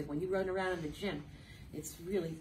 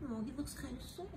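ordinary delivery

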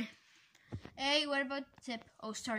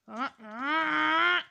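short fake crying; mock cry; 4 semitones higher, 8 dB louder than usual. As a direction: whimper like a kid. Launched from the cannon.

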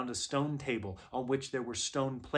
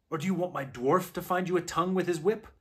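higher, louder, rapid threat; threatening witch; 6 semitones higher, 6 dB louder, faster than usual. A narrator's reading. Witch threatens the animals who will not answer, menacing. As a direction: rush the threat out, raised in pitch and volume.

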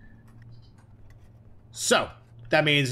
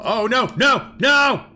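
panicked shout; 11 semitones higher, 6 dB louder, much slower than usual. Real, not acted. Falling off the edge.

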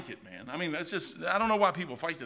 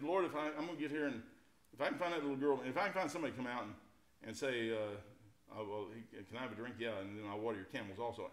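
hushed prayer; 6 semitones lower, 9 dB quieter than usual. Acted. Eliezer's quiet prayer.

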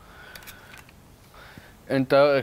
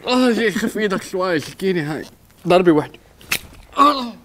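pained panic; 7 semitones higher, 5 dB louder than usual. Real, not acted. Coach stabbed, in pain.

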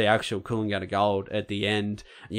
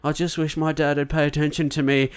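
put-on a higher, plaintive kid's voice; neglected younger son; 6 semitones higher than usual. Retelling the film, younger son wishing his dad noticed him, plaintive. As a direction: sound like the overlooked little brother.

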